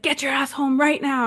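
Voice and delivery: high-pitched imitation of an angry order; mock parental yell; dad losing it. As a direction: bark it like a furious father. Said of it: "losing it".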